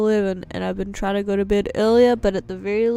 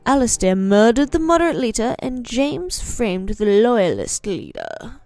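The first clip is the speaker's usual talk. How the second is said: louder announcing read of the solution; reading the result; 6 dB louder than usual. Acted. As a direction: declare the verdict like an announcer. Reads out the solution text.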